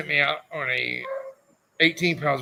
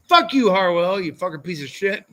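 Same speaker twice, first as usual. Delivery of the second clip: louder, hammed-up cursing at a friend; mock angry outburst; 5 semitones higher, 4 dB louder than usual. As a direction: yell at your buddy in play anger. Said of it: "I get mad at that".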